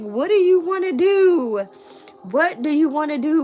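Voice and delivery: exaggerated singsong pestering question; mock nagging. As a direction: imitate people pestering you, stretched and high. Mimics everybody nagging her.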